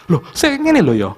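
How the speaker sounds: the father pushing back even harder. Retold or imitated. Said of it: "Oh, tambah kenceng lagi".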